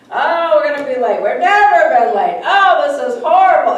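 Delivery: loud, laughing panic about being late. Retold or imitated. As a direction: wail it, half laughing, that you'll be late. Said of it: "she was that loud".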